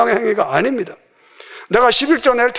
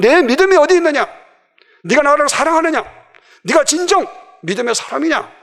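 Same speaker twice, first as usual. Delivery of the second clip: raised, higher-pitched preacher's challenge; challenging questions; 6 semitones higher than usual. Acted. Preacher voicing the Lord questioning believers' faith, high-pitched challenge. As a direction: press each question home, high and insistent.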